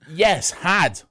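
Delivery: HAD punched high and loud; emphatic correction. Real, not acted. Rebutting the co-host about an old Welsh accent, emphatic correction on "had".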